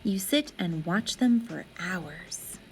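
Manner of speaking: ordinary delivery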